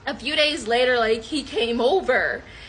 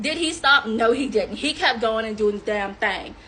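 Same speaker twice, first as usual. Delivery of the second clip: ordinary talk, nothing marked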